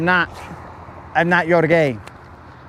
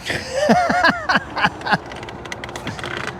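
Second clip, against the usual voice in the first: high laugh; 5 dB quieter than usual. Real, not acted. Laughing at passerby.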